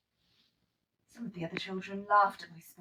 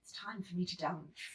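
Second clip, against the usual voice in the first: usual speaking voice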